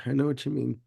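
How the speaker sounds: ordinary talk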